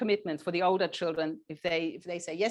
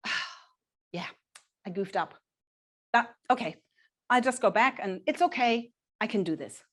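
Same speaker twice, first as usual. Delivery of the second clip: shrugging it off; 3 semitones higher than usual. Shown as a demonstration. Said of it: "If you say".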